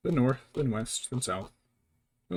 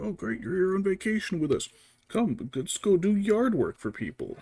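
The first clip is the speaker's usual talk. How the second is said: higher, cheerful imitation of the father-in-law, slowing at the end; mock father-in-law; 8 semitones higher, 4 dB louder than usual. Mocking the father-in-law inviting the guest to do yard work, higher and cheerful. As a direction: lightly mimic an eager host. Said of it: "He's like".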